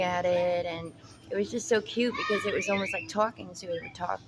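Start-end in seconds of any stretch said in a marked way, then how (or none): none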